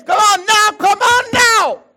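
high, loud cry of exhortation; preaching shout. Real, not acted. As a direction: call it out to the whole room, full force.

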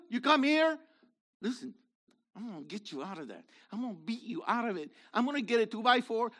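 playful, fast mock threat to the listeners. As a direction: rattle off a pretend threat with a grin. Preacher's mock threat to congregation.

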